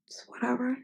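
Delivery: dismissive whisper, almost no voice. Acted. Shrugging off the argument in a whisper. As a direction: brush it off under the breath.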